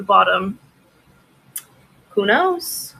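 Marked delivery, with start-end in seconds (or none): 2.1-2.9 high sing-song shrug. Real, not acted. Shrugging at mystery mug.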